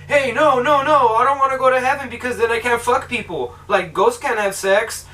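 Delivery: mock protest. Ghost refusing to go to heaven, mock protest. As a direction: imitate a ghost objecting in a rush of refusals. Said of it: "he's all like".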